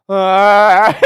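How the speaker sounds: high, loud startled cry laughing; shocked gasp. Retold or imitated. Tripping man startled by the mirror, loud gasp. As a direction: recoil at his own reflection.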